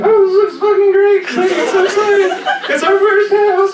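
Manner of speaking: very high, excited cartoon bunny voice; high squeaky bunny wife. Rabbit wife gushing over first burrow, very high and excited. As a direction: pitch way up as the thrilled rabbit wife.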